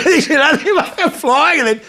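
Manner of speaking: high pitched, laughing through it. Real, not acted. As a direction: keep laughing as you tell it. Laughing while retelling story.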